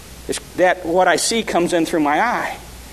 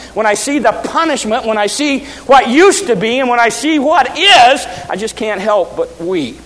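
preaching; 5 semitones higher, 5 dB louder than usual. Acted. Preaching on Jeremiah's weeping.

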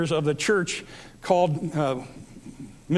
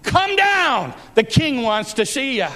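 high, drawn-out captain's summons; crying out; 8 semitones higher, 4 dB louder, slower than usual. Retold or imitated. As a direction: holler a command up the hill. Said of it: "the captain cries out to him".

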